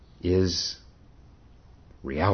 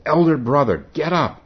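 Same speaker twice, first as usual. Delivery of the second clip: high, loud call to wake someone; 10 semitones higher, 9 dB louder than usual. Acted. Monk waking his sleeping companion.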